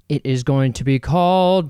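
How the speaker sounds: ordinary delivery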